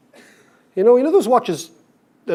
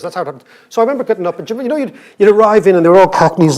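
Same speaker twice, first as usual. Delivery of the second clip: usual speaking voice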